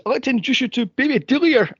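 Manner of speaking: high fast mock-proud introduction; mock posh mother. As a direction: gush like a proud yuppie mum. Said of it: "she goes".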